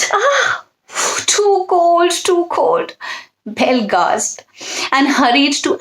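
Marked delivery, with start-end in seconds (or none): 0.1-3.0 much higher yelping dish voice; a dish squealing at cold water; crying dish. A narrator's reading. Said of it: "it cried".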